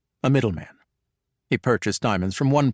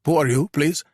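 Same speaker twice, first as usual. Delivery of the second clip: curt Armenian guard; 4 semitones higher than usual. Acted.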